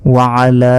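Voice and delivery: "আলা" with the final লা held for three beats; long drawn-out vowel. Shown as a demonstration. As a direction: stretch the last vowel of "আলা" long and steady. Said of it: "তিন আলিফ পরিমাণ টেনে পড়তে হবে". ("আলা"-র শেষ লা তিন আলিফ টেনে)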